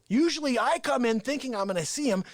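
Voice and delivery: ordinary delivery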